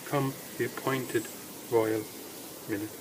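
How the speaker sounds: ordinary delivery, nothing marked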